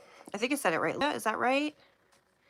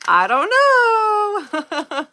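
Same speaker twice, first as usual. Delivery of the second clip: I don't know stretched slow, much higher and louder; drawn-out high I don't know; 12 semitones higher, 11 dB louder, much slower than usual. Real, not acted. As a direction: sing out a long, high, giggly I don't know. Repeats shrug, then drawls it.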